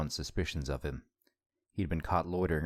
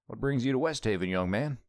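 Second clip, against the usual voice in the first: put-on Southern accent; 7 semitones higher, 3 dB louder than usual. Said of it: "and boy was that accent heavy".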